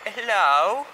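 singsong rising greeting to a pet; cooing greeting. Real, not acted. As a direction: coo hello to a little animal. Greeting the turtle they found.

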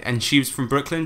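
ordinary talk, nothing marked

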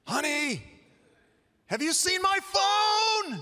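high, loud, slow sing-song call; calling across the house. Retold or imitated. Spouse calling out for a lost phone, high and loud. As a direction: yell it high from the next room.

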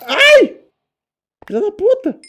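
shrieking very high then cursing; pained scream. Real, not acted. Gamer struck by a mob, shrieking curse. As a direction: yelp at the hit, then swear loudly. Hit by an enemy in game.

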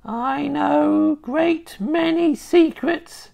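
put-on high, slow old hag; sly witch voice. A narrator's reading. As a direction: draw out the secret slowly. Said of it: "she said".

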